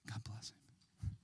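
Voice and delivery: whispered aside, slow. Real, not acted. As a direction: murmur it under your breath. Aside about a child.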